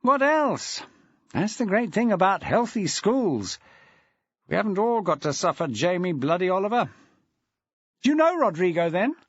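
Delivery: put-on a deputy head greeting an old mate; Aaron Felton, jovial. A narrator's reading. Aaron Felton greeting Maxwell on the phone, jovial.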